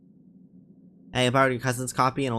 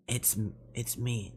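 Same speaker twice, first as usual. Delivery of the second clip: put-on tired old guy; 8 dB quieter, slower than usual. Shown as a demonstration. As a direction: try a tired old man, slowly and quietly. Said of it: "a tired voice".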